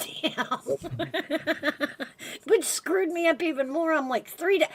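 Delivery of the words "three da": high, alarmed retelling of her own shock; mock panic. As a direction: replay the panic of losing three days, pitched high.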